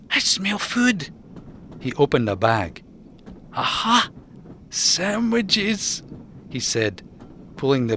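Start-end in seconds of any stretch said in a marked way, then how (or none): 0.1-1.1 much higher, slow eager raccoon; hungry Ian. Acted. Ian to Mac, high slow delight at the smell. 3.5-6.0 much higher delighted raccoon; triumphant find. Acted. Ian to Mac, higher delighted discovery.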